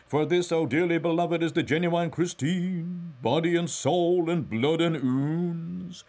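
high, loud, drawn-out mock sermon; preacher's tone. A narrator's reading. Mulligan mocks the Mass to Stephen, preacher's tone. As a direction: preach it slowly on a sustained high pitch. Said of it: "added in a preacher's tone".

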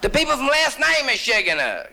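very high, insistent caller voice; Mister Schechner. Acted. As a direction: pipe it up high to jog a memory.

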